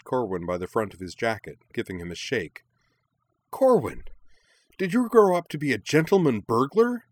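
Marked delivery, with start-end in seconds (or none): 3.5-7.0 high, loud, delighted accusation; gleeful demand. A narrator's reading. Minami shakes her friend by the jacket, gleeful demand. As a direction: pounce on it with gleeful disbelief. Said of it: "with a look of gleeful realization".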